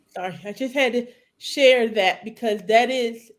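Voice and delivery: normal talking voice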